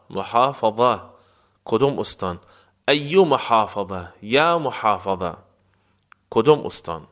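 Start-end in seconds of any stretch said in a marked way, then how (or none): none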